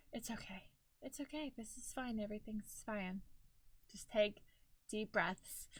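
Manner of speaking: very soft, hushed self-reassurance; calm yourself down, barely voiced; self-soothing. Real, not acted. Talking herself down from money stress, hushed and soothing.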